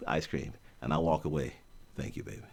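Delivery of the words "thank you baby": low, hushed croon; very soft deep thank-you. Retold or imitated. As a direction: murmur it like a lover.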